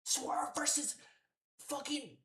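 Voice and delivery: real exasperated yell; losing it. Real, not acted. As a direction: blow up at the company, yelling. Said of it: "I'm losing my shit".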